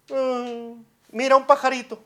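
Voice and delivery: loud, high sing-song grandmother soothing; grandma comforting. Retold or imitated. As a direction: coo over a crying toddler like a granny. Grandma distracting crying child.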